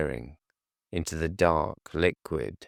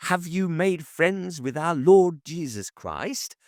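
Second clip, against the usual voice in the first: high, louder, pressing and earnest; eager young preacher; 12 semitones higher, 4 dB louder than usual. A narrator's reading. Young missionary pressing silent Hashiva, high and insistent. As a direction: push the question, full of conviction. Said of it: "the young man pressed".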